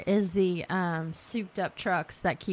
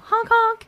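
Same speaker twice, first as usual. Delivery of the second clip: honking noise; 8 dB louder than usual. Retold or imitated. Imitating a car horn for signs.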